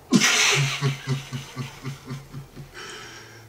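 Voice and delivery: laughter bursting out, then trailing on; long laugh. Real, not acted. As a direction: laugh it out at length. Laughs at own remark.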